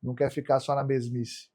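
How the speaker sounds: usual speaking voice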